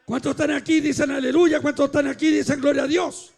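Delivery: loud, driving Pentecostal exhortation; rally the congregation with a loud, forceful call; full-force preaching. Real, not acted. (predicación a plena voz)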